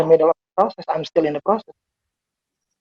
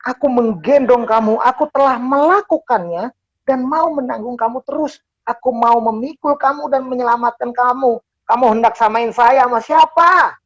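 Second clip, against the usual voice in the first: raised, emphatic voice of God; higher, insistent divine declaration; God speaking; 6 semitones higher than usual. Acted. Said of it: "Dia kayak bilang gini dong".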